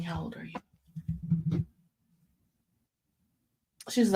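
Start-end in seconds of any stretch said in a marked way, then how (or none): 0.0-1.7 slow hushed whisper; whispered question. Retold or imitated. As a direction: ask it slow and secret. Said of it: "I say".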